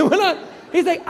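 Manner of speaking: ordinary talk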